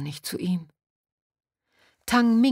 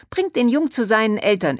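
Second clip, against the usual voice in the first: loud, high, worked-up voice; agitated demand; 6 semitones higher, 7 dB louder than usual. A narrator's reading. Pharmacist demanding the boy be taken home, agitated. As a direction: call out in indignant agitation. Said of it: "die aufgeregte Stimme der Apothekerin".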